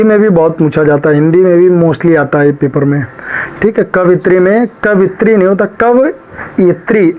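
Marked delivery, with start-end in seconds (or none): 5.8-7.1 कवयित्री drawn out syllable by syllable, क-व-यि-त्री, higher; teacher stretching कवयित्री so each syllable is clear; spelling it out slowly. Shown as a demonstration. Teacher drills class on कवयित्री, slow and syllabic. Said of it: "आप लोग ऐसे याद करा करो".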